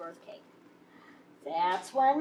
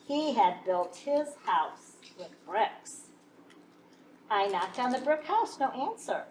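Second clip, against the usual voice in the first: plain reading voice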